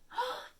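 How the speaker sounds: sharp high gasp; surprised gasp. Real, not acted. Librarian gasping at the snow-water surprise, high gasp. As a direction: gasp in delight.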